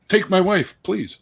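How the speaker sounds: high-pitched hint of an old-time comic; Henny Youngman. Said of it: "my, my Henny Youngman".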